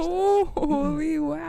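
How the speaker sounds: slow, high, eerie chant; drawn-out spooky intro. Pretend. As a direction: stretch it out like a ghost. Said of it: "let's do it again".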